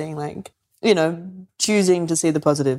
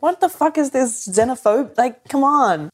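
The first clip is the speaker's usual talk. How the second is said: incredulous protest; 7 semitones higher than usual. Retold or imitated. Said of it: "I'm like".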